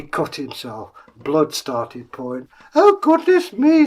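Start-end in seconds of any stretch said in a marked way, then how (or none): 2.7-3.9 high, loud gasp of shock; horrified royal exclamation; shocked queen. Acted. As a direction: recoil at the sight.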